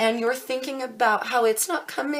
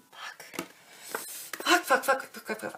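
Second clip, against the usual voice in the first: whispered swearing; 3 semitones higher, 4 dB quieter than usual. Real, not acted. Bad card drawn, swearing.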